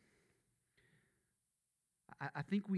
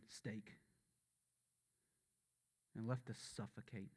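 somber hushed preaching. Acted. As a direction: let the weight of the cross settle. Somber account of the crucifixion.

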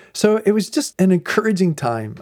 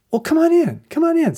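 high, hurried-along welcome called out; imitated lieutenant inviting; 3 dB louder than usual. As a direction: call the visitors in, pitched way up. Lieutenant waves the visitors in.

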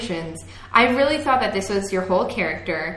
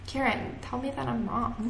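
very soft, slightly higher plea; hushed Emma impression; 3 semitones higher, 11 dB quieter than usual. Shown as a demonstration. Retrying the character's line for a co-host, very quiet. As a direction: play it tiny and hushed. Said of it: "do it in your impression".

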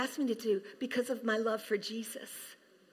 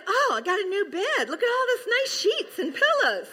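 much higher, laughing delight; 9 semitones higher than usual. Acted. Someone walking into a made bed, high and laughing. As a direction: gush over the fresh bed.

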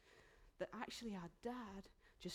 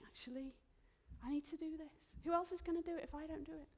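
put-on indignant older son; 8 semitones higher, faster than usual. Voicing dutiful older son.

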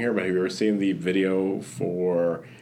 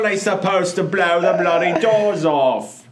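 Michael Caine impression; 10 semitones higher, 7 dB louder than usual. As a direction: correct the quote in full Caine voice. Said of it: "you're thinking about Michael Caine".

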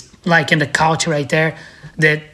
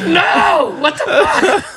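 outraged shout; 9 semitones higher, much slower than usual. Retold or imitated. Watching Vern give his bearings away, laughing outraged shout.

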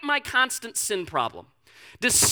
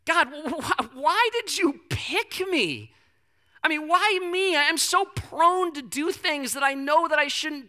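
higher, slowed, bewildered lament; pleading with God; 6 semitones higher than usual. Retold or imitated. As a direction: plead as if alone in the car. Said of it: "I literally sit there and I just go".

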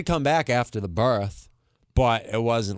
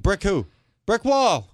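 prank call voices; 9 semitones higher, slower than usual. Retold or imitated. Joke prank call exchange.

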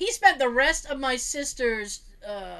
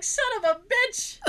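mock exasperated curse; 11 semitones higher than usual. Mom's mock frustration.

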